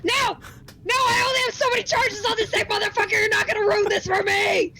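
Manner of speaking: high, frantic angry shout. Acted. Player fighting off a voice controlling her body, shouting. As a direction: shout in protest at the thing controlling you. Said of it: "Do we see her shouting?".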